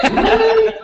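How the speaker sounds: very high, loud, laughing whoa; surprised laughing shout; amazed whoa. Retold or imitated. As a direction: gasp it out in laughing astonishment. Client's reaction acted out.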